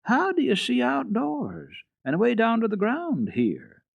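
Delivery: a hint of a curious little girl; eager child. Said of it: "Heidi said at once".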